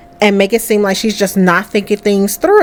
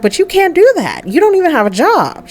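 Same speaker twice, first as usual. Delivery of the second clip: higher-pitched mother's objection; exasperated scolding; 9 semitones higher than usual. Acted. Mother scolding pregnant daughter.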